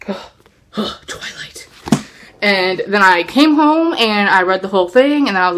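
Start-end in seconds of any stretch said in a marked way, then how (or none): 0.0-0.0 sudden gasp of being hooked; a quick thrilled intake of breath; sharp gasp. Retold or imitated. Reading on the bus, she gasps at the book. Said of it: "I was just like". 0.0-1.7 breathless whispered title; awed whisper. Retold or imitated. Hooked on the bus, she whispers the title in awe. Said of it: "I was just like".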